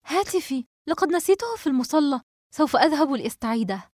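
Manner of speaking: high-pitched startled panic; alarmed. A narrator's reading. Girl finds her phone missing from her pocket, alarmed. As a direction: blurt it out in sudden alarm. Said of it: "منى متحسسة جيبها مفزوعة".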